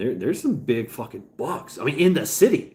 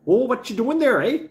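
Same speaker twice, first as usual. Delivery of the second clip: much higher, louder, exaggerated Canadian lilt; mock Canadian accent; 13 semitones higher, 6 dB louder than usual. Teasing chat, a hammed-up high Canadian accent. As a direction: bounce up on "eh" like a cartoon Canuck.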